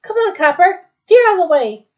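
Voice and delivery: high, loud storybook shout; urgent warning. Acted.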